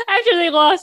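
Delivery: can't stop giggling while telling it; laughing. Real, not acted. Friend laughs retelling the shop owner's bet, real laughter.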